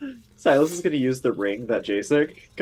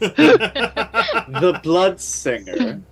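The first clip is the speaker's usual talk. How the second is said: dramatic announcer voice; 6 semitones higher, 7 dB louder, much slower than usual. Acted.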